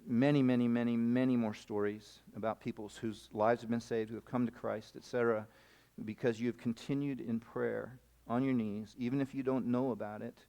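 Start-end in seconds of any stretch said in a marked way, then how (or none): none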